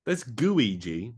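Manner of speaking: drawn-out excited announcement. Real, not acted. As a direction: stretch it out, thrilled. Excited naming of character.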